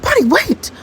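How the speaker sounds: high desperate call after her. Acted. Girl calls after Bonnie leaving, high shout.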